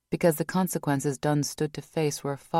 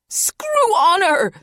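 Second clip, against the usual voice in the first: much higher, loud and slow wailing cry; 11 dB louder, much slower than usual. Acted. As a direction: drag it out in despair. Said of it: "she wailed".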